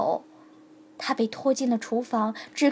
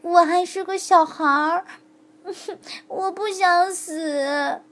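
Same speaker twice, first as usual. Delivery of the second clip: frightened plea; 5 semitones higher, slower than usual. A narrator's reading. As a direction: plead for her life. Girl fears death. (小珠子慢而轻地含泪说)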